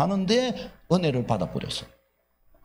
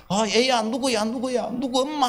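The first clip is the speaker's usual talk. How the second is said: higher, frantic calling out; desperate calling; 9 semitones higher than usual. Retold or imitated. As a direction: call for your family, panicked. Calling family after the crash. (사고 후 가족을 찾으며 다급하게 부르는 남편, 높은 목소리로)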